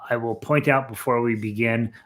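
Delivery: ordinary delivery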